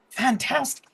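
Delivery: high gnome marvelling; fascinated gnome. Acted. Gnome checks visitor's hand, high fascinated voice. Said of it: "he looks and he goes".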